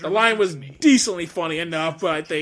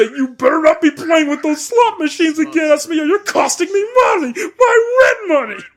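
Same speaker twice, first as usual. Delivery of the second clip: high, loud, angry cartoon shout; Vector; 12 semitones higher, 9 dB louder than usual. Scolding over the phone about slot machines, high furious cartoon shout. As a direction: yell at a gambler, stretching out the final words. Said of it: "me as the voice of Vector".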